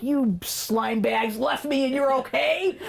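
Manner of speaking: slow, high-pitched mock outrage; angry toddler. Abandoned toddler to his returning parents, angry. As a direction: voice a betrayed kid's indignation, drawn out. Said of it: "so angry".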